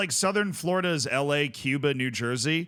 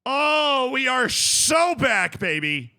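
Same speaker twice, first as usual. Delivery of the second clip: excited shout; 8 semitones higher, 6 dB louder, much slower than usual. Real, not acted. Celebrating the club.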